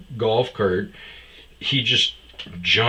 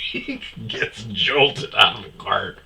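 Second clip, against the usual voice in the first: words breaking into real laughter; laughing while telling. Real, not acted. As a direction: struggle to talk through giggles.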